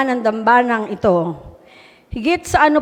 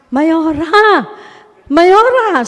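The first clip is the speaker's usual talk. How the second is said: calling out higher and higher, a mild mock cry; retold shout; 5 semitones higher, much slower than usual. Bernie crying out to the mayor in church, imitated shout. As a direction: reenact someone crying out across the church. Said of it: "merong sumigaw".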